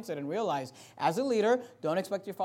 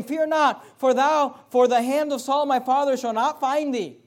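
impassioned preaching; 7 semitones higher, 9 dB louder than usual. Acted. Preacher acting Jonathan cheering David on. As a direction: lift the whole room.

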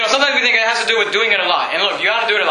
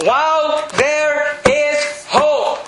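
drawn out; 6 semitones higher, much slower than usual. Acted. Preacher stretching scripture.

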